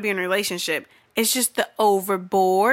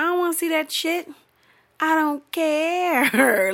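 exasperated drawl; 8 semitones higher, slower than usual. Real, not acted. Exasperated dismissal of the couples.

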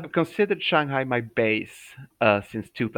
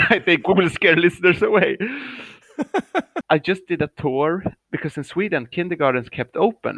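ordinary delivery

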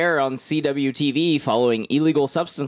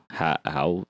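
usual speaking voice

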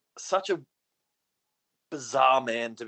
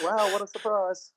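sarcastic wow; 8 semitones higher than usual. Acted. Teasing after tangent admission.

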